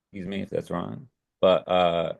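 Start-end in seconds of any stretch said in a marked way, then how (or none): none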